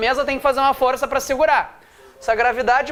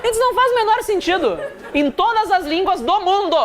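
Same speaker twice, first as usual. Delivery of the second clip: laughing disbelief; 6 semitones higher than usual. Real, not acted. Exasperated laughing rant about banana.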